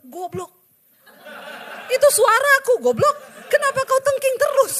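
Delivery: loud, high, laughing mock scolding as God. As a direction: bellow it as an exasperated God, half laughing. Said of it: "Tuhan bilang". (suara Tuhan menegur dengan keras dan tinggi sambil tertawa)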